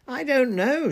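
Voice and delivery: very high, loud shout. A narrator's reading. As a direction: shout back at full pitch. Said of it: "shouted Kingsley".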